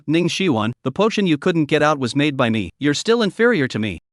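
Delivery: plain reading voice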